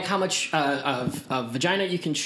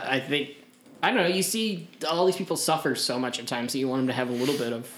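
normal talking voice

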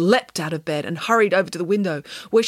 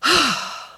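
high, short sigh; exasperated sigh; 8 semitones higher than usual. A narrator's reading. Nanny woken by a nagging ghost, heavy sigh. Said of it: "Nanny Piggins sighed".

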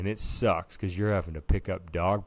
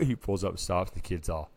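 ordinary talk, nothing marked

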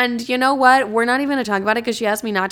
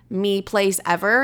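ordinary talk

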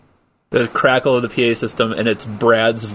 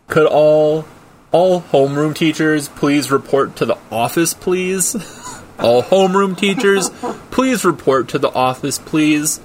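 put-on kid faking a grown-up voice; 5 semitones higher than usual. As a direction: kid trying to sound older on the PA. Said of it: "trying to make himself sound older ... little kid, like, low voice".

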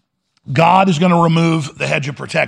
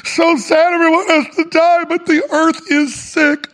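put-on much higher, trembling, tearful old man; weepy Shatner; 9 semitones higher than usual. Host mocks William Shatner crying after the spaceflight, high and tearful. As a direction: wail it like a weepy old actor back from space. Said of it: "and goes".

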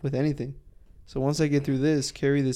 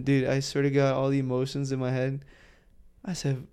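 ordinary delivery

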